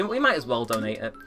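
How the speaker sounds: ordinary talk, nothing marked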